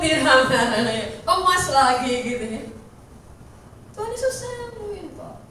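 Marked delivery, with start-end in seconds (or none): none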